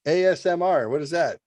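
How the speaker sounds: high mock-naive stream host; goofy streamer. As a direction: play the baffled dad on stream.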